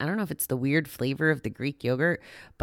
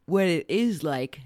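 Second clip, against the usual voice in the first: yawning. Real, not acted. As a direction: talk through a yawn. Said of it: "And I'm yawning as I'm telling you".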